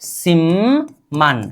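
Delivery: सिमन very slow, with a long hummed mm; demonstrated pronunciation. Shown as a demonstration. Teacher shows how we will pronounce it, slow model pronunciation. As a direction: stretch सिमन into simmm, then man. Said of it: "अब इसका उच्चारण हम करेंगे".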